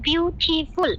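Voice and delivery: Beautiful slowly, split as biu-ti-ful; broken into syllables. Shown as a demonstration. Teacher demonstrating multi-clap adjectives, slowly broken into syllables. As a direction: break beautiful into separate beats. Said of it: "ভেঙে ভেঙে বলতে হয়".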